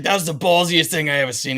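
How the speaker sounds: a hint of an impressed East Coast guard; JoJo impression. The guard praising the shot, light East Coast accent. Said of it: "I love your JoJo impression".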